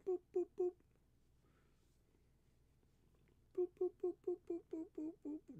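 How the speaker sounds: high squeaky booping, playful; sing-song high boops to tease the cat. Real, not acted. Owner booping his cat's nose in squeaky baby talk.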